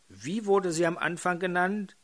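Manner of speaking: ordinary talk, nothing marked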